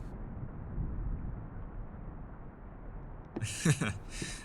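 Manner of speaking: quiet airy chuckle; soft laugh; soft breathy laugh. Acted. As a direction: laugh under your breath. Laugh after guest's reply.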